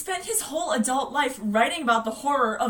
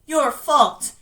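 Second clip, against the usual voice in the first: louder, accusing jab; raised accusation; 9 dB louder than usual. Acted. Dead George blaming his brother Bill, loudly accusing. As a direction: lay the blame on him, louder.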